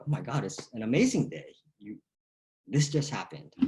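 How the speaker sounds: upbeat answer. Shown as a demonstration. Coach demonstrates a thrilled reply to a greeting. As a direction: sell the amazing day. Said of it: "You go".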